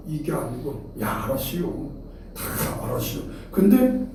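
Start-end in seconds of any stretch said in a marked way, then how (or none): 1.0-3.2 softer, agreeable Chungcheong dialect; imitated Chungcheong yes-man. Preacher mimics someone agreeing to anything, soft Chungcheong accent. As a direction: give a mild Chungcheong-style nod of assent. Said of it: "충청도 사람은 그래 놓고는".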